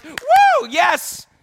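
high, loud whooping yes; delighted shout of yes; excited whoop. Retold or imitated. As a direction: cheer it out proudly. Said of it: "I'm like".

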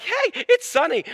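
high, drawn-out cheery exclamation; delighted at nice weather. Acted. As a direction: sing out the good news. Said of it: "The weather is nice".

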